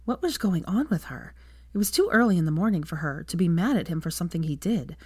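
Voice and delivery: ordinary delivery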